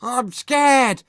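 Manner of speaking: high, loud panicked shriek; Anxious Andrew shriek. A narrator's reading. Nervous boy watching horror, shrieking. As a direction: shriek it in terror. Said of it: "shrieked anxious Andrew".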